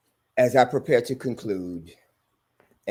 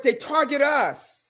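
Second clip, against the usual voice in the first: fiery preaching. Real, not acted. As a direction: preach it out to the congregation. Preacher back at full force.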